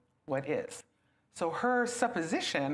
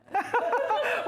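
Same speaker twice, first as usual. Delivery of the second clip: laugh; 4 dB louder than usual. Real, not acted.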